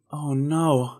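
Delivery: usual speaking voice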